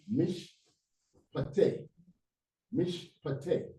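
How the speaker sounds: "mish" closed on sh, pause, then "pate" as pa-TE; teacherly syllable breaks in "mish, pate"; closed syllable demo. Shown as a demonstration. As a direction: sound out "mish ... pate" in separate pieces. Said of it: "to close this syllable".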